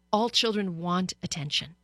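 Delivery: ordinary delivery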